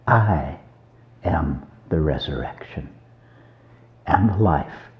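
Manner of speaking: hushed solemn quote. Retold or imitated. Preacher quoting Jesus to Martha, hushed and solemn. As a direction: say it softly, with pauses, like a sacred line.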